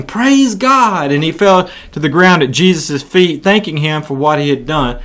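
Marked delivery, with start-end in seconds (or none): 0.0-1.1 mock joyful shout, pitched high; a leper shouting thanks, mildly acted. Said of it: "he came back to Jesus shouting".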